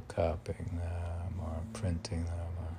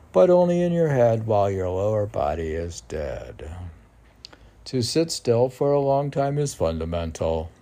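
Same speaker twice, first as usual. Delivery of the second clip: louder, declaimed verse; loud recitation; 7 dB louder than usual. Acted. Poet repeating his poem aloud to the host, declaiming. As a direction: declaim it boldly.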